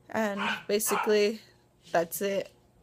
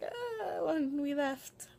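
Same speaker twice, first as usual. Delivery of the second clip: high, soft mock wail, sliding down slowly; imitated crying; 6 semitones higher, 5 dB quieter, much slower than usual. Vlogger retelling her tears after results, imitating a soft cry. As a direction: act out a small, drawn-out cry. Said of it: "I was trying not to cry".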